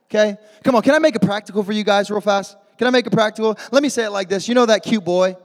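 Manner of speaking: ordinary talk, nothing marked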